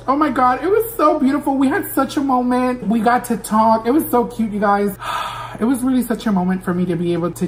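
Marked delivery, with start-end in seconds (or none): 0.1-4.5 higher, giddy "it was so beautiful"; excited gushing. Real, not acted. Gushing to viewers about meeting [name], excited. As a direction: bubble over with delight.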